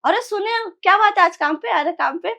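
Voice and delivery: high, excited call to a friend; hearty greeting. Retold or imitated. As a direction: greet a pal with surprise. Friends greeting him loudly.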